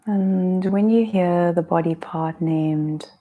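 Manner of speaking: unhurried yoga nidra guidance, drawn-out phrases; guided meditation. Real, not acted. Yoga teacher guiding listeners through breath and intention, guided meditation.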